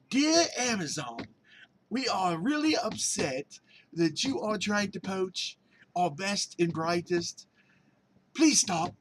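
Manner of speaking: high, slow, prim British accent voicing a stern letter; mock British accent. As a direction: read eBay's letter as a fussy, high-pitched Brit, slow and proper. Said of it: "Maybe that's why I went into the British accent".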